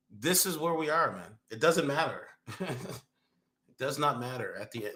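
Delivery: normal talking voice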